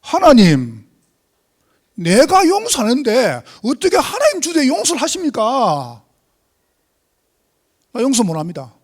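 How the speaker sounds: bitter defiant prayer, loud; indignant cry to God. Shown as a demonstration. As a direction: fling the complaint at God, furious. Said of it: "잘 한번 들어보세요".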